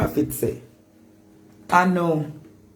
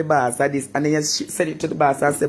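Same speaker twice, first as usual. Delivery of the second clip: ordinary delivery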